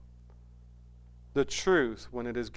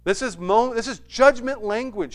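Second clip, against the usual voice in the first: higher and louder, driving the point home; preaching at full force; 8 semitones higher, 8 dB louder than usual. Real, not acted. Preacher warning the unrepentant crowd, loud and intense. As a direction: pound out each word like a verdict.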